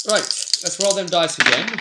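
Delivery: rallying himself to roll the dice; eager. Real, not acted.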